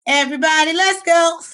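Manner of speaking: high-pitched, playful call-out ending in laughter; mock rallying call. Rallying everyone to leave, jokingly.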